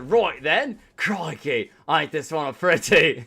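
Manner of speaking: loud jokey Australian accent; laughing Australian impression; mock Australian. Shown as a demonstration. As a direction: ham up an Aussie voice. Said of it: "go into the Australian".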